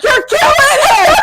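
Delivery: high, loud shrieking accusation; mother screaming that they are killing her son; hysterical shout. Retold or imitated. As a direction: shriek it at the guards. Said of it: "she's like".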